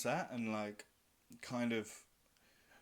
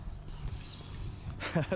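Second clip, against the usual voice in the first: breathy laugh; 6 semitones higher than usual. Real, not acted. Laughs at own loneliness.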